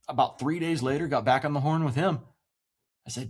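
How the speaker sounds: ordinary talk, nothing marked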